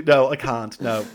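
normal talking voice

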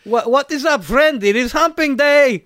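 mock Eastern European accent. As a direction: greet a friend in cheery broken English. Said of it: "guise of the European man".

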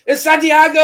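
high, booming self-announcement, imitated; Santiago. Caller announcing himself on the phone, imitated as a high shout. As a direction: shout the name like a big entrance. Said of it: "He's very loud".

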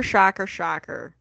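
slow, deadpan repeat of shocker, shocker; sarcastic drawn-out shocker, shocker; drawn-out sarcasm. Pretend. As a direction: drag each shocker out, unimpressed. Sarcastic repeat of plot twist.

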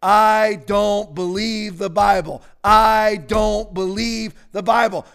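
slow, emphatic recitation; slow call and response. Shown as a demonstration. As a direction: lead them word by word. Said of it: "come on, say it after me".

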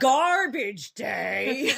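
long drawn-out loud yell; mock movie yell. Quoting the killer's famous line, mock movie yell. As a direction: holler it slowly, hammed up.

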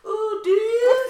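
high, loud, slow laughing squeal. Real, not acted. Mocking her own wish to marry, laughing high.

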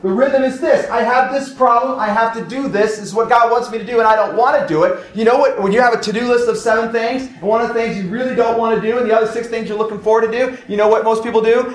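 fervent pulpit exhortation; preaching at full force. Acted. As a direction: press the point home to the congregation. Preaches on to-do priorities.

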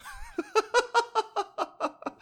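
high burst of laughter. Real, not acted. Laughs at own joke.

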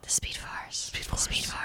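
very soft, mock-mysterious whisper; playful hushed whisper. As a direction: whisper it like a mystical echo. Hushed echo of the name.

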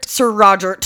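Sir Roger said as "Sir Rogert"; T on the end. Shown as a demonstration. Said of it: "With a T".

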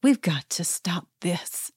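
slow, hushed, voiceless whisper; mock-earnest hushed plea; imitating Sutton's whisper. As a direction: whisper it like a secret plea to the table. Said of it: "and she says".

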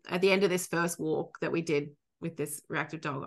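ordinary delivery

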